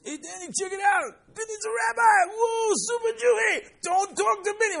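a teen mocking his friend to the others; mocking buddy. High-school buddy ribbing his praying friend, high loud jeer.

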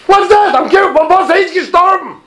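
loud, very high retold outcry; exclaimed challenge. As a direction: fire the rabbi's question out high and loud. Repeats rabbi's challenge to students.

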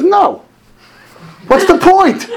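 high, loud exclaimed dismissal; acted blunt refusal. Rabbi refusing a father's request for blessing, acted emphatic dismissal. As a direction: play up the emphatic refusal for laughs.

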